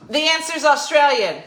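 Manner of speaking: loud, high mock-outraged announcement; emphatic correction. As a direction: overrule the wrong answer at full volume. Corrects audience member's wrong answer.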